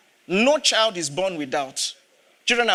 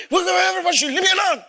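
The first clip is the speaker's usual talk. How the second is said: fast, much higher, louder exasperated shout; exasperated outburst; 9 semitones higher, 3 dB louder, faster than usual. Retold or imitated. Father snapping at his wife and kids: exasperated shout. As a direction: blurt it out, fed up with everyone.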